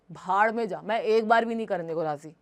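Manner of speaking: usual speaking voice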